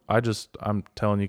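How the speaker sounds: ordinary talk, nothing marked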